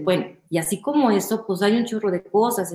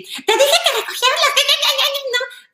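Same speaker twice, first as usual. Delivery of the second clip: much higher, fast, shrill mock scolding; hammed-up mother telling a kid off; scolding mom; 4 dB louder than usual. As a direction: nag like an exasperated mother. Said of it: "cuando tu mamá te regañaba".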